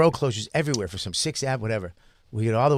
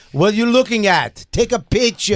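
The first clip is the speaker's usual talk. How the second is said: put-on African accent; 6 semitones higher, 4 dB louder than usual. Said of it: "I can't do an African accent".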